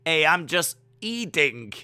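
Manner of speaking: normal talking voice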